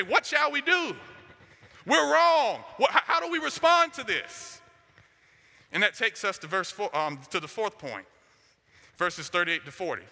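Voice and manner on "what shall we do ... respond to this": urgent plea. Retold or imitated. Preacher acts out crowd's plea.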